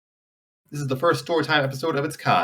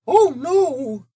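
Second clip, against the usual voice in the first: cartoon alarm. Mickey about to be crushed.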